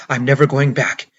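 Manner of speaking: loud, panicked scream of refusal; screaming at the police, desperate, loud; desperate shout. Acted. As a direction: yell it like you're cornered. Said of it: "It came out as a shout, a desperate scream".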